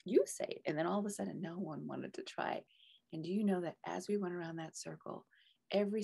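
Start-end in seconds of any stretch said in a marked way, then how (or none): 0.0-0.6 playful challenge. Retold or imitated. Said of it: "And I said".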